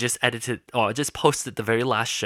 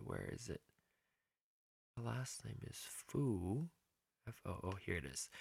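much softer, to herself; muttering; 14 dB quieter than usual. Real, not acted. Host searching Facebook messages under her breath. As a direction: drop away from the mic and mutter.

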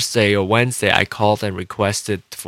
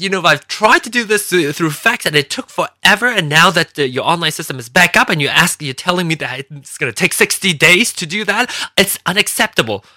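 retold angry complaint; 7 semitones higher than usual. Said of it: "I was yelling".

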